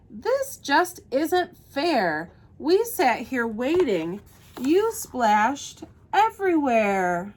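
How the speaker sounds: slow, emphatic storybook complaint; grumbling. A narrator's reading. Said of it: "The other two grumbled".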